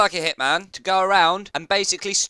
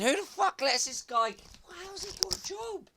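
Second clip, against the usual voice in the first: pitched-up distorted; 14 semitones higher, 11 dB quieter than usual. Acted. Distorted high pitched clip.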